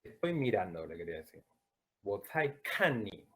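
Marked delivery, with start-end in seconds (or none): 2.0-3.3 "kan" said with no puff of air; unaspirated Mandarin kan. Shown as a demonstration. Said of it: "No largué aire".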